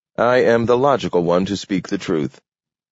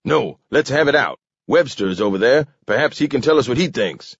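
higher, worked-up demand; sputtering; 7 semitones higher, faster than usual. A narrator's reading. Alderman King demanding Webster's opinion, sputtering. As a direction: insist on having it out. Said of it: "King sputtered into life".

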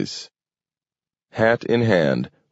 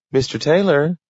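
higher, firm insistence on the name; insistent; 10 semitones higher than usual. A narrator's reading. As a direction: repeat the name firmly. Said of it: "insisted the girl".

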